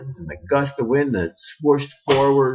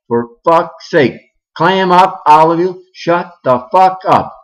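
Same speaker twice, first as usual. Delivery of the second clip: fed-up yell; 6 semitones higher, 6 dB louder than usual. Acted. Janet yells at kids to quiet.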